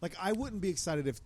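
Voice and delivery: ordinary talk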